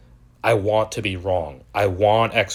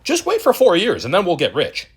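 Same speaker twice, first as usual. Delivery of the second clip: mocking naive investor; 9 semitones higher than usual. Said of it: "all these guys were saying".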